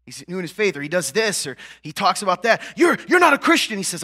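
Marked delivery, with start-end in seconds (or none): 2.8-3.8 high, loud mock accusation of the critics; imitating the critics, much louder and higher; accusing critics. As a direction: imitate the Christians who attack him. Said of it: "they come at me and they say".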